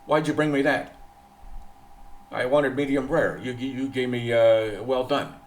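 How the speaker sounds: mock the foreigner barking at the waitress; imitating a rude complaint. Imitating a loud foreigner complaining to the waitress. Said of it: "talked like a normal American".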